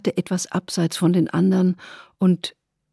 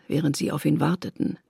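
ordinary delivery

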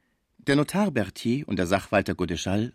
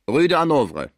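loud, raised call; 6 semitones higher, 7 dB louder than usual. A narrator's reading. Director calls to his coachman, loud call. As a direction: call the address out, higher and louder. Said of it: "rief dem Kutscher zu".

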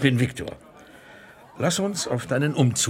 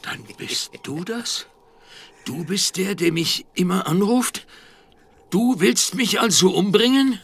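fearful; 6 semitones higher, 4 dB louder than usual. Acted. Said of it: "Welle fürchterlicher Angst".

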